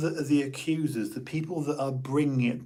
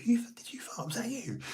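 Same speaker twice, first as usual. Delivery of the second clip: whispered, higher mock outrage; animated fake accusation; 10 semitones higher, 8 dB quieter than usual. Schoolboy deflecting a fart onto classmates, mock outrage. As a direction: ham up the blame, hushed. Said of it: "in a very animated way".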